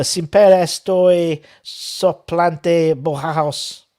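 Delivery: slow, loud, halting Spanish; practising Spanish. Shown as a demonstration. Fan translating the club song, halting put-on Spanish accent. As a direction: sound out the Spanish word by word. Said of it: "we can all practice our Spanish".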